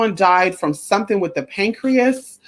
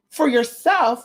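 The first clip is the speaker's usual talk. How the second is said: ordinary delivery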